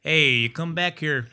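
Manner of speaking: slow Western drawl; lightly voiced cowboy; Western accent. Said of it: "or like, you know, like Western".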